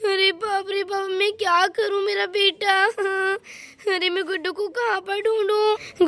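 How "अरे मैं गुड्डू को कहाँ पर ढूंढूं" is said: plain reading voice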